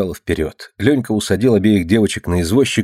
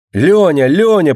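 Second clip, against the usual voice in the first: high, louder joyful call; excited echo; 9 semitones higher, 5 dB louder, slower than usual. A narrator's reading. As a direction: take up the cry excitedly. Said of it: "подхватила Алина". (радостно, громко и высоко)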